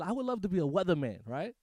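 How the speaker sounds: ordinary talk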